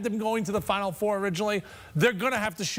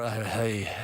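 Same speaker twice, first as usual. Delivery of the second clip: sultry voice, pitched way down; low bedroom voice; 11 semitones lower than usual. Shown as a demonstration. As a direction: drop it low and sultry for the demo. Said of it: "a little more, like, lower".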